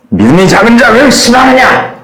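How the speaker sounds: sharp reproach; rebuking. Shown as a demonstration. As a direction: scold the sinking Peter. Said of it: "세 명이 다르게 얘기를".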